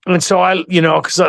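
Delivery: ordinary talk, nothing marked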